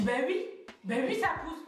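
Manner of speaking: quick, high, emphatic confirmation; retold urgent insistence. Woman in labour answering midwife, retold urgency. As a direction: answer as if it were obvious, pressing.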